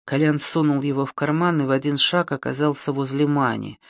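usual speaking voice

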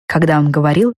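usual speaking voice

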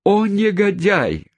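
high, drawn-out mock cry; hammed-up indignation; outraged exclamation. A narrator's reading. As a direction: wail the insult slowly in a high pitch. Governesses exclaim at the intruder.

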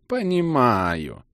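slow, offhand cut-off; dismissive. A narrator's reading. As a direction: brush him off lazily. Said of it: "небрежно оборвал меня маг".

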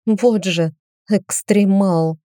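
ordinary delivery

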